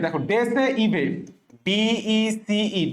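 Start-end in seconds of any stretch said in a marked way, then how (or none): 0.3-1.0 ডেসে ইভে spelt out as "de-se, i-bhe"; memory-trick pronunciation. Shown as a demonstration. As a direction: sound every e in ডেসে ইভে. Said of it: "এভাবে মনে রাখি".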